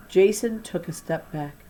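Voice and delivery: ordinary delivery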